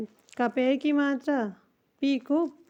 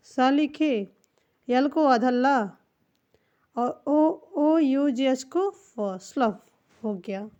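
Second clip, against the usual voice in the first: ordinary talk, nothing marked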